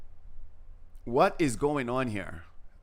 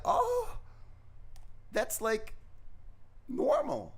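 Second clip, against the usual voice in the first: much higher, unimpressed woman's voice; imitating the hygienist; 13 semitones higher, 3 dB quieter, slower than usual. Retelling the Russian hygienist's reaction, high imitation. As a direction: mimic her shrugging it off, pitched way up. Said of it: "She's like".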